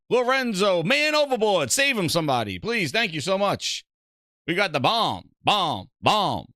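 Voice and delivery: high, loud rapid-fire thank-yous; hype shoutouts. Real, not acted. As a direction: rattle off the names with energy.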